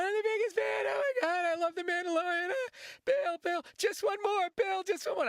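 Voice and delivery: put-on high-pitched, squealing little kid fan. Kid fan begging Bill to sign, high squeal. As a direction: gush like an overexcited kid begging for an autograph. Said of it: "And he kept going".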